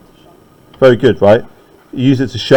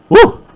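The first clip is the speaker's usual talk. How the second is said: mock cheer; 5 dB louder than usual. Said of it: "What a manly thing to say".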